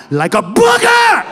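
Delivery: high yell on the last word; drawn-out, rising holler; emphatic shout. Acted. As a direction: drive the point home, blowing up on BOOGER. Hammering home his analogy.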